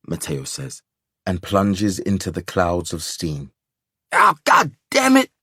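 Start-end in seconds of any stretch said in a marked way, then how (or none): 4.1-5.3 high, loud burned cry of pain; pained yell. A narrator's reading. Mechanic burned by steam, yelling in pain. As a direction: yelp as the steam scalds.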